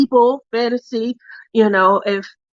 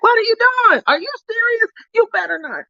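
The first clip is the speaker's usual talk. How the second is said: scolding mom; 13 semitones higher than usual. Retold or imitated. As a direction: warn like a shocked mother, pitched up.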